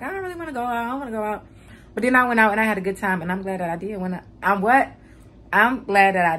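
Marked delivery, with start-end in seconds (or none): 0.0-1.5 mock reluctant, soft and lifted; hesitant. Retelling not wanting to go out last night, softly reluctant. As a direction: play back a soft, higher-pitched reluctance. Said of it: "I was hesitating".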